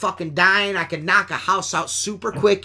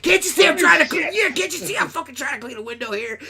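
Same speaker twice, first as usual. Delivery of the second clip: higher, cartoonish cussing grumble; Yosemite Sam; 6 semitones higher than usual. Cleaner cussing at a customer's bush, a cartoon cowboy rant. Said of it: "like Yosemite Sam".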